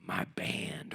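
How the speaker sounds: soft unvoiced whisper. Real, not acted. Comedian whispers fondly to crowd about his band. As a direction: breathe it like a secret. Intimate aside.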